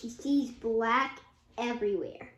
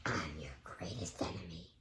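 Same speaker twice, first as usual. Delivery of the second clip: villain whisper; 12 dB quieter than usual. Acted. Villain reveals himself to Kai.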